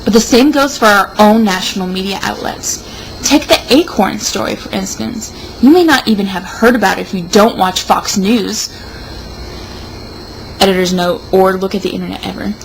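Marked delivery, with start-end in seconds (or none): none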